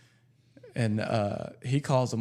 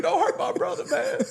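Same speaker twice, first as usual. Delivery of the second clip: high-pitched mock pleading; comic high wail, half laughing; prank call plea; 14 semitones higher than usual. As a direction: imitate an upset caller in a squeaky, raised voice. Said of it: "getting more and more mad".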